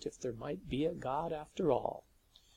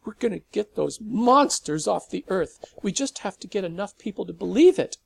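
emotional declaration; 7 semitones higher, 11 dB louder than usual. A narrator's reading. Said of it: "His voice shook with emotion".

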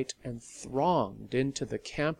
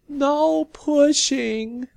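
very slow, much higher and louder whine; slightly whiny; 11 dB louder, much slower than usual. Acted. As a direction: whine like a squabbling child. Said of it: "came a slightly whiny".

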